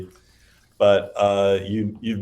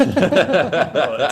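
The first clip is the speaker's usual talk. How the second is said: laughing; 8 semitones higher, 8 dB louder than usual. Real, not acted. Laughing as the answer dawns.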